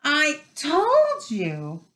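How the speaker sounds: high, slow winded reproach; gasping. A narrator's reading. Hermione repeats herself to her friends, gasping. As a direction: drag the words out, short of breath. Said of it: "Hermione gasped, clutching at the stitch".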